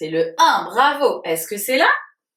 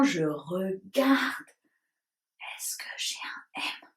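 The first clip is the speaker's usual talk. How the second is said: whisper; 5 semitones lower, 10 dB quieter than usual. Acted. Teacher searching for the letter M.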